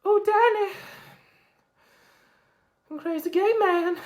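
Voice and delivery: very high squeaky caricature; long shrill impression of Brian. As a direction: keep the voice pinched way up high. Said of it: "the Brian voice".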